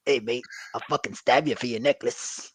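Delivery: mock-menacing Aussie caricature. Shown as a demonstration. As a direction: threaten like a cartoon Australian. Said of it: "stereotypical if I'm gonna do an Australian accent".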